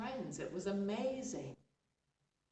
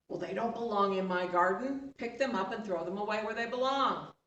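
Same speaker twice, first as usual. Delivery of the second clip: louder, commanding; annoyed order; 3 semitones higher, 8 dB louder than usual. Acted. Chief irritably orders servant to clear the bones.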